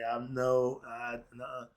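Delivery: usual speaking voice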